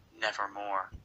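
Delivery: low, soft raven's croakless refrain. A narrator's reading. Raven answers the man's muttering, low and soft. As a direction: intone it low and hushed as the bird. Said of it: "Then the bird said".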